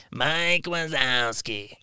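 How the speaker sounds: slow, lightly voiced cartoon monster; Mike Wazowski voice. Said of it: "now I'm just doing voices".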